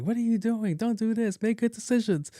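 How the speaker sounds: high-pitched scolding list; imitated nagging parent. Parent lecturing a grown child, mock nagging. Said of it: "like, your parents".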